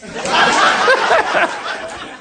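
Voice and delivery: high, breathless long laugh; real high-pitched cackling laughter. Real, not acted. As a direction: burst into a long helpless laugh. Laughs at his own story.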